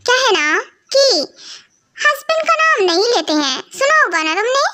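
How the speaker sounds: mother-in-law lecture. Acted.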